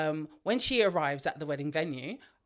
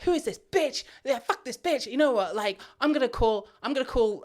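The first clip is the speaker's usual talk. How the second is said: high, louder jealous rant; outraged bride; 7 semitones higher, 4 dB louder than usual. Retold or imitated. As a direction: spit it out like a betrayed bride. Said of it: "And she's like".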